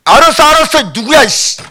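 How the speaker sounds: high, loud, impatient reply; irritated outburst. Acted. God answers someone bawling in His face, irritated. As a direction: cut off the wailer in mock annoyance. God startled by wailing up close.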